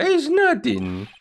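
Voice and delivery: high, slow, airy lady voice; high sugary woman's voice, slowed down; eerie high lady. Acted. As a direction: draw it out sweetly and creepily.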